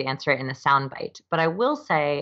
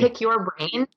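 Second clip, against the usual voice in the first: higher, scornful echo of the phrase; mocking echo; 7 semitones higher, slower than usual. Guest mocking the cliché request to the host, scornful and higher. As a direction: toss the phrase back with a mocking lilt. Echoing the dreaded VIP request.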